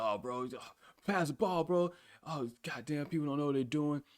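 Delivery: mock a complaining teammate begging for the ball; whiny teammate. Retelling a headset teammate who whines for the ball, mock whine. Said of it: "He's like".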